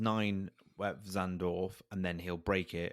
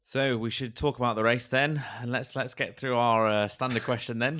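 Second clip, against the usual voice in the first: podcast host voice; 5 semitones higher, 10 dB louder, slower than usual. Acted. Hosts the podcast segment.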